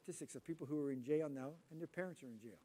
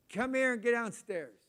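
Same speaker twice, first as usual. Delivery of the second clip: loud, slow fatherly order; stern call; 7 semitones higher, 9 dB louder, slower than usual. Retold or imitated. Father calling his son downstairs, loud and stern. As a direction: call him down like an angry dad.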